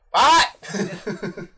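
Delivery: loud, high-pitched "what?" of disbelief; baffled yell. Retold or imitated. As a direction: blurt it out like a viewer who can't make out a word. Acting out baffled viewer of muffled dialogue.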